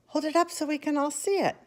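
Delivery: crowd shout. A narrator's reading. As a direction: call out over heads. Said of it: "somebody shouted".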